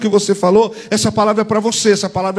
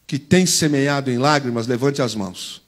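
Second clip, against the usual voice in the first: ordinary talk, nothing marked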